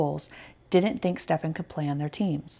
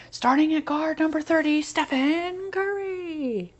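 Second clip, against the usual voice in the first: announcer voice; 10 semitones higher, slower than usual. Acted. Boy dreams of NBA intro.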